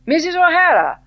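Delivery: high, loud, beaming welcome; hearty greeting. A narrator's reading. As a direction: hail her across the courtyard. Sir John hails her.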